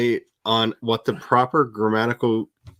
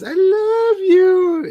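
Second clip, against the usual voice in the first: high, slow meowing cat voice; squeaky slow kitty purr voice; 6 dB louder, much slower than usual. Retold or imitated. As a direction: stretch it out like a needy cat. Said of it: "She just goes".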